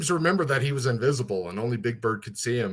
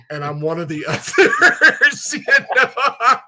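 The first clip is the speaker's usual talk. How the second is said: usual speaking voice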